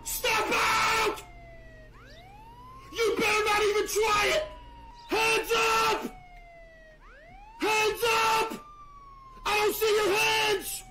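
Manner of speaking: barked police commands at a driver; mock cop shouting. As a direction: yell it like a cop making an arrest.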